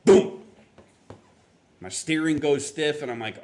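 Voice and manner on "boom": sudden loud vocal "boom"; explosive imitation of a breakdown noise; imitated bang. Preacher imitating the car breaking down, loud boom. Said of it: "all of a sudden I hear".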